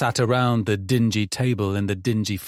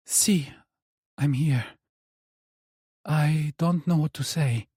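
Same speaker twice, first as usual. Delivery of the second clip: higher, hesitant mother on the phone; the distant mother, higher and unsure; mother's voice; 5 semitones higher than usual. Acted.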